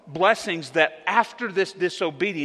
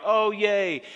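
higher, a quick cheer of the crowd; mock crowd cheer; 6 semitones higher than usual. Pastor voicing the crowd's cheer as water pours out, mock excited. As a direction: toss off the crowd's happy cheer. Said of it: "And the people cheer".

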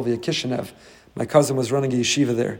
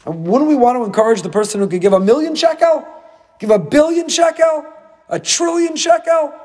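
climbing higher with each bigger sum; incredulous escalation; 13 semitones higher, 5 dB louder than usual. Acted. Preacher questioning the limit on big donors, in rising incredulity. Rhetorical escalation of donation sums.

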